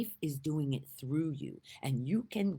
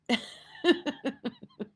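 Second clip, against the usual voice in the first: high laugh; 14 semitones higher than usual. Real, not acted. Laughing at herself.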